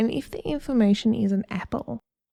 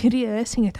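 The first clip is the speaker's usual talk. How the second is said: ordinary talk, nothing marked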